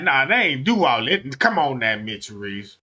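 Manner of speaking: a hint of Mitch Reese's voice; mock Mitch Reese brushing it off; lightly voiced Miss Teresa. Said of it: "that's how Mitch Reese talk for real".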